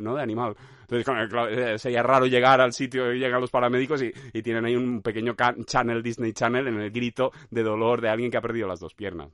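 usual speaking voice

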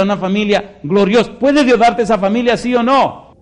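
loud, high, ringing preaching; preaching at full force. Acted. Preacher rousing the congregation, loud and high. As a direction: drive each question home to the crowd.

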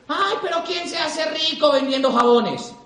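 voz burlona más aguda y lenta; escéptico burlón. Retold or imitated. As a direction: imita a un incrédulo que se ríe del negocio. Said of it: "la gente te dice". (gente escéptica burlándose: tono burlón y aguado)

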